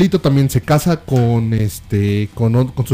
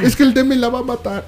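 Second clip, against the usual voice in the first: much higher, whiny fear; mock frightened daughter; 9 semitones higher than usual. Daughter fearing Demetrio will kill her mother, much higher. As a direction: whine it like a scared girl.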